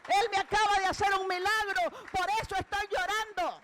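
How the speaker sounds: retold tearful woman. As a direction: retell her tearful testimony with rising fervour. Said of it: "la mujer entre sollozos le dice".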